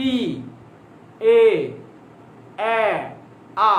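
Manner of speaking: ই, এ, এ, আ each said alone with long pauses, lips opening wider each time; isolated Bengali vowels ই এ এ আ, spread apart; vowel demo. Shown as a demonstration. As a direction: sound ই then এ then এ then আ, widening the mouth step by step. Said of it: "আমি বললাম ই".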